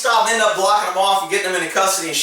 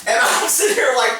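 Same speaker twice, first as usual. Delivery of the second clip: ordinary delivery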